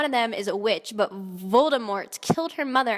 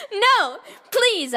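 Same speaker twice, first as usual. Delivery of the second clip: higher, louder pleading; mock begging; 8 semitones higher, 4 dB louder than usual. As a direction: beg like a hammed-up victim. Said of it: "I begged".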